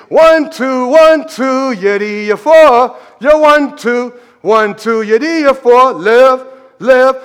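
loud rhythmic marching call; military cadence caller. Shown as a demonstration. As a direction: call cadence like a boot camp recruit. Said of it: "and you call cadence".

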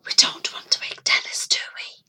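soft unvoiced whisper. Mrs Hobbs hushing Norman at the bedroom door, whispering. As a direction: hush them so nobody wakes. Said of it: "she whispered".

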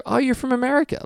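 high-pitched mock American accent. Host imagining greeting a Canadian, in American accent. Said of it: "say it to them in an American accent".

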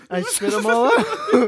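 ordinary delivery

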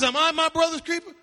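indignant rising question; Cain defiant retort. Acted. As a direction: fling it back at God. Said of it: "He says".

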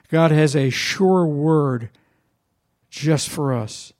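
slow, weighty, each word set down; "sure" drawn out and leaned on; slow declaration. Shown as a demonstration.

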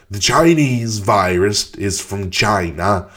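slow, drawn-out mock Trump voice; slow exaggerated Trump accent; bad Trump impression. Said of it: "That's the worst Trump accent ever".

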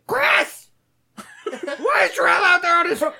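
very high, loud mock scolding; Sally. Manager demanding why a worker is outside, high mock yell. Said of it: "fucking Sally".

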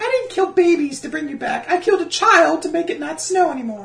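high falsetto excuse; mock Melisandre. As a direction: squeak it like a defensive priestess.

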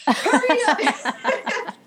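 loud, high, drawn-out mock yell breaking into laughter; comic holler, high and slow; imitated yell. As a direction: imitate a yelling instructor, stretching it out. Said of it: "he's yelling at everybody else".

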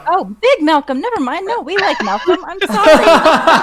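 laughing embarrassed. Real, not acted. Sudden embarrassed realization.

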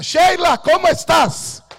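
high, loud, urgent call; shouting her name. Retold or imitated. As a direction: call out to her as if across a room. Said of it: "yo gritaba".

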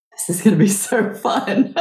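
real giggle bubbling up; amused aside. Real, not acted. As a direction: say it giggly, laughing at the end. Playful aside, breaks into laughter.

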